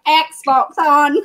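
laughing, Scottish "Xbox on" with short rounded o; mock Scottish "Xbox on". Shown as a demonstration. Mocking her brother to friends, laughing Scottish "Xbox on". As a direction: say "Xbox on" in broad Scots, giggling. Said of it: "in an American accent, not".

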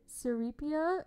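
"Serapia" said slowly as "suh-REE-pia"; imitated mispronunciation. Recalling how a friend said it, slow mock mispronunciation. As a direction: stretch "Serapia" with a stressed "ree". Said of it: "that's how you said it".

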